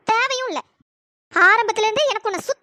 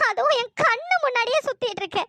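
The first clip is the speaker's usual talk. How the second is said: ordinary talk, nothing marked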